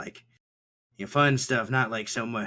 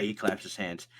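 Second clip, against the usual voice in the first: ordinary talk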